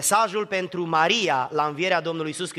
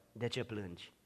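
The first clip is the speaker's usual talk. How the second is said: much softer, lower „De ce plângi?”; hushed question; 5 semitones lower, 12 dB quieter than usual. Acted. Jesus to the weeping one, hushed. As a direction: ask it gently, barely voiced.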